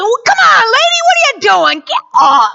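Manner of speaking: high, loud, exasperated goading; tough spurring. Acted. Preacher acts out prodding someone to get up, loud urging. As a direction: push them hard to get up. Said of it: "Sometimes it's not a".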